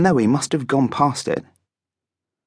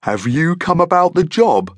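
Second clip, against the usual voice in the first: higher, friendly monster voice; 8 semitones higher, 3 dB louder than usual. A narrator's reading. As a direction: welcome the job applicant as a genial monster. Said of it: "in a friendly voice".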